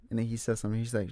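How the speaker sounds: ordinary delivery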